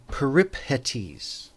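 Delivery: Peripetes said with P and H sounded apart, "per-ip-HET-eez"; common English pronunciation. Shown as a demonstration. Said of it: "the common pronunciation would be".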